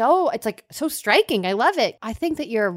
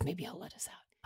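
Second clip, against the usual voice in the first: whispered aside to the party; hushed, secret scheming; conspiratorial whisper; 7 semitones lower, 22 dB quieter than usual. Acted. Prisoner whispering a plan to the others, conspiratorial whisper.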